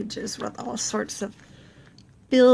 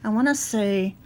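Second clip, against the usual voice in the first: ordinary delivery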